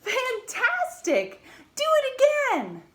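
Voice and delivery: high, loud, slow cheer; shouted. Acted. Princess urging the dragon on, loud excited shout. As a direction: cheer him on to tire him out. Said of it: "Elizabeth shouted".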